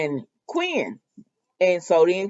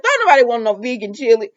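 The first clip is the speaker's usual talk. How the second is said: ordinary delivery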